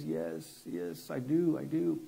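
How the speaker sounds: soft, tearful-sounding retold yes; imitated tearful assent. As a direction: quietly imitate someone crying in agreement. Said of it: "she just starts crying".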